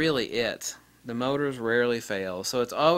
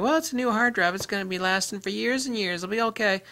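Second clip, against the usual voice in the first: much higher, mock naive voice; naive user; 9 semitones higher than usual. Mocking a naive user who copied data once. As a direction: imitate someone sure they're fine.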